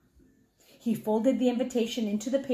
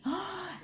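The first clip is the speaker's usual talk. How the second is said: gasp; 6 semitones higher, 9 dB quieter than usual. Acted. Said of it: "He was so excited".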